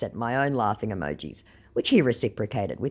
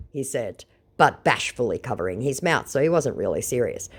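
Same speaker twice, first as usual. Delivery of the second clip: ordinary delivery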